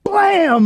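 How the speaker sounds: vocal door-bang effect; imitated blam. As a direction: bark out the crash sound. Door kicked open.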